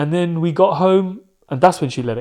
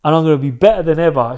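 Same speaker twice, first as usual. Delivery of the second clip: pumped-up, louder retelling of mum; hyped-up; 5 dB louder than usual. Retold or imitated. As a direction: punch it out like a comeback promise. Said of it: "She was on such a hype thing".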